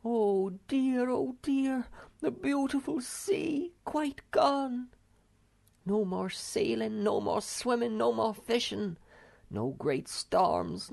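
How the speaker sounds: high, mournful lament; wailing Snufkin. A narrator's reading. Wanderer mourns the dried-up sea, high lament. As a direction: grieve for the lost sea. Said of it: "sat down with his head in his hands and wailed".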